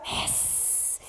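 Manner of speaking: long breathy cat hiss with no voice. Shown as a demonstration. Teacher shows kids the cat pose, long hiss. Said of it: "hiss like a cat".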